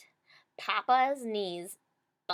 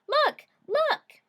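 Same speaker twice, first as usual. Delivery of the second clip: high, loud little bear crying out; frantic baby bear; wee little bear voice; 8 dB louder than usual. A narrator's reading. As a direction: squeak it out like a tiny cub in alarm. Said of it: "his wee little voice".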